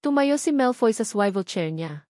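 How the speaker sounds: plain reading voice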